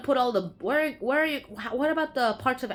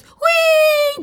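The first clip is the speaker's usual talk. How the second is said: gleeful whee; 14 dB louder than usual. Acted. Playful cry after the title.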